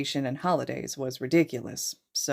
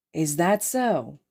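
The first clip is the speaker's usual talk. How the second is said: amused; slower than usual. Acted. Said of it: "an amused look".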